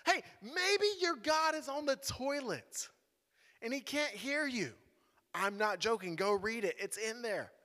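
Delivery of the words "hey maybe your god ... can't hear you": high, slow, drawn-out taunt; mocking. Elijah to rivals whose god is silent, mocking. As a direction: tease them with mock-helpful suggestions. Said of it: "he mocks them a little bit more".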